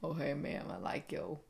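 low, slow mock creep voice. Joking to co-host about approaching a mom, hammed-up creepy. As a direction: put on a low creepy voice, breaking into a laugh. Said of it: "But I'm not gonna go".

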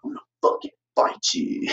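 hushed, breathy retold threat; muttered threat. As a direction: mutter it under your breath like a menace. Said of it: "So he's like".

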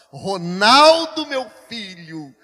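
booming, drawn-out stern call; insistent changed tone. Retold or imitated. Holy Spirit calling a resisting pastor, loud insistent tone. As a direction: stretch the name out loud and commanding. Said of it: "Depois, quando eu resisto, ele fala".

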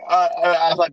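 ordinary talk, nothing marked

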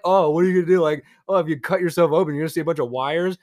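loud, high mocking quote of people; loud mocking imitation; mocking others. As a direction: scoff as you imitate them. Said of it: "people are like".

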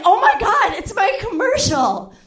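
thrilled recognition; startled delight at the TV; excited shock. Retold or imitated. Seeing herself on TV.

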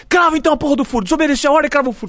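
high, loud rapid command; mimicked boss's tirade; retold shouted order. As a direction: yell it fast as the exasperated boss. Retold boss ordering the scoop.

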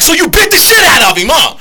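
high, shouted, egging him on; hyped therapist dog. Acted. Therapist dog hypes up the patient dog, shouting. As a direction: cheer him on like a buddy. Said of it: "The dog would be like".

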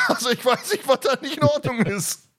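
high-pitched, laughing through the words; amused speech tipping into real laughter. Real, not acted. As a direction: crack up while commenting. Amused at the retold scene.

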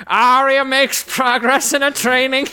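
loud, high, drawn-out mock oratory; slow, booming caricature of a wartime orator; Winston Churchill. Shown as a demonstration. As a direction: read it as a hammed-up statesman's address. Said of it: "I'll do Winston Churchill".

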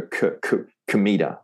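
"comida" as kuh-MEE-da, after two short kuh's; schwa mistake. Shown as a demonstration. Teacher imitates the common error, "comida" starting kuh. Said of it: "We don't wanna go".

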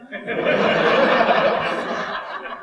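breathy real laughter at the punchline; laughing at the husband's face dropping. Real, not acted. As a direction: let the laugh out.

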